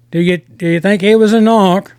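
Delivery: put-on a touch of Irish accent; lightly imitating an Irish gangster. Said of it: "I can't do a big, heavy Irish accent".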